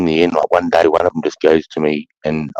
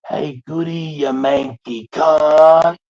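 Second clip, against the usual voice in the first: high, long drawn-out holler; imitated long-distance call; 11 semitones higher, much slower than usual. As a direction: call out slowly to someone far away, stretching the words. Mate calls out across distance.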